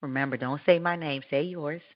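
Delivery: ordinary talk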